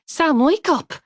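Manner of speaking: loud, high desperate call; panicked shout at him; urgent shout. A narrator's reading. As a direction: yell to break through. Shakes him harder now.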